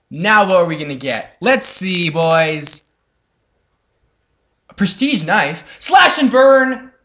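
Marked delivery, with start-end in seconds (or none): 5.8-6.9 excited shout. Real, not acted. Reads new weapon name.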